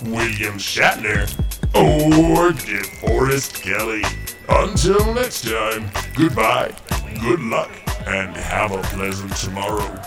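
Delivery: normal talking voice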